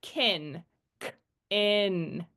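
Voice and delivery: "Kin" then split into "k ... in"; sounding out for spelling. Shown as a demonstration. Student spelling bacon, separate sounds "kin", "k", "in". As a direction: break Kin into K and a stretched "in". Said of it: "When they go to spell words".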